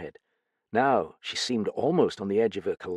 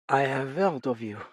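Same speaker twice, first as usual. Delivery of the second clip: a lightly voiced tired hostess, a touch higher; weary older woman; 5 semitones higher than usual. A narrator's reading. Lightly voiced widow greeting her visitors. Madame Duchesne speaks.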